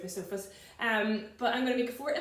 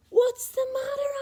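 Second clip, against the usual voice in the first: much higher, sing-song concerned giant; kind giant voice; 13 semitones higher, 7 dB louder than usual. Acted. George asking the sad giraffe, high and caring.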